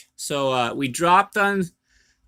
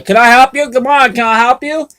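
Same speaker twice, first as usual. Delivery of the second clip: intercom voice; 9 semitones higher, 7 dB louder than usual. Retold or imitated. Retold intercom guard answering.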